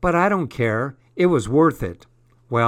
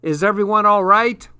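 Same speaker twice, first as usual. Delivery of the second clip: loud, high, eager question; enthusiastic and keen; 8 semitones higher, 6 dB louder, faster than usual. A narrator's reading. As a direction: check in keenly, pitched well up. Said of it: "He was all enthusiastic and keen".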